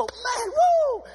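high, loud whooping cheer; mock stadium whoop; sports fan cheer. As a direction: let it rip like your team just scored. Cheering a sports team win.

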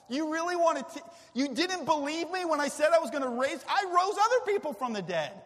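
loud, high exasperated rant; frustrated rant. Pretend. Imagined Jesus ranting at doubting disciples, loud and high.

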